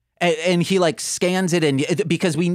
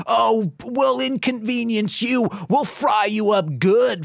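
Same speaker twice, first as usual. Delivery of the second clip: taunting Turbine; 7 semitones higher than usual. Retold or imitated. Turbine threatening his kidnapper Prince Squid, high and sing-song.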